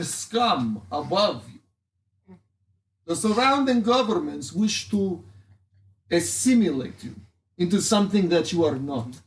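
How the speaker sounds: put-on lightly done Russian accent speech; rally the crowd in a Russian accent. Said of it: "I have a Russian accent".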